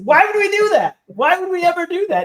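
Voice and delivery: high, loud incredulous protest; exasperated disbelief. Retold or imitated. Wrestler retells protesting to his partner, high and exasperated. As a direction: shoot way up in pitch, loudly baffled.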